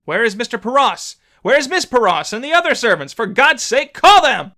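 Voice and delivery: put-on a priest shouting at the servant girl for help; crying out in alarm. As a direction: call desperately for help. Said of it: "I cried out to the servant girl".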